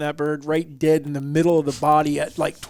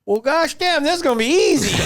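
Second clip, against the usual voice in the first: high, laughing imitation of dad; 11 semitones higher, 4 dB louder than usual. Dad chuckling that the hunt will be easy. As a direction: laugh it out like a delighted old man. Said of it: "And my dad's like".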